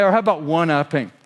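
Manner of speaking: usual speaking voice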